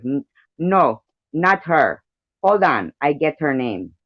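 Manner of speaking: Russian-accented impression of Vladimir. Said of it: "how I do his voice".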